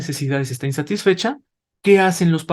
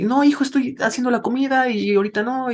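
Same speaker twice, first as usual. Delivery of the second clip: lightly voiced, higher mother saying no; a hint of a distracted mom refusing; busy mother; 9 semitones higher than usual. Mother cooking refuses her son's plea to play, lightly distracted. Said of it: "Mamá".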